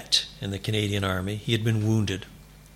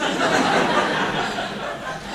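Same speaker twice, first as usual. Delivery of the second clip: real laughter. Real, not acted. Laughing at own story.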